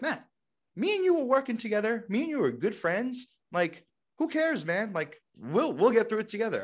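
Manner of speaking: a friend talking him out of a slump; coworker's pep talk. Retold or imitated. Coworker lifts Matt's mood, lightly voiced. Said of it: "And he's like".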